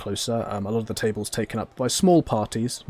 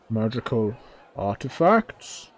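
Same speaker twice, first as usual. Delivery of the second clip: slow, drawn-out intrigued question; curious forge dweller; slower than usual. Acted. Forge dweller hearing of magical goods, slow and curious. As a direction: let the second word rise with interest. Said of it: "a curious pause".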